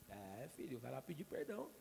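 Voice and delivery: a hint of a gentle divine voice; voice of God. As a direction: answer like a calm patient father. God answers the preacher.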